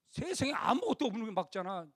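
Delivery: ordinary talk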